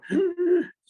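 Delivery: big high gasp of delight; mock gasp. Showing kids a jump from sad to excited, high gasp. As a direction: gasp in pretend sudden joy. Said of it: "You don't go".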